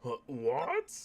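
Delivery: ordinary talk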